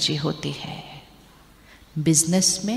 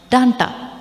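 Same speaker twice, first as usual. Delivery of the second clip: mock scold; 5 semitones higher, 6 dB louder than usual. Scolding word acted out.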